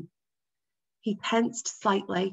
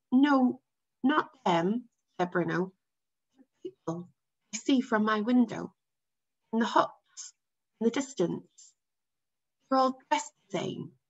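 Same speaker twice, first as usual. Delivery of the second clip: put-on boy's voice; 3 semitones higher than usual. A narrator's reading. Boy explaining to father.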